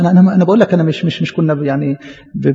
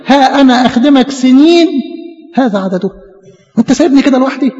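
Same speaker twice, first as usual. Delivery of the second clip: pitched-up hurt reproach; aggrieved complaint; 7 semitones higher, 3 dB louder than usual. Acted. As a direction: drag out the years of service in protest. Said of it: "قال له".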